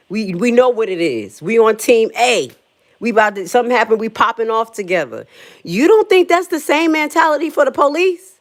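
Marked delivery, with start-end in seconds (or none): 5.6-8.3 high, loud, incredulous challenge; worked-up question pitched way up; getting loud. Real, not acted. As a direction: raise your voice on a pointed question. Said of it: "Sorry, I'm getting loud".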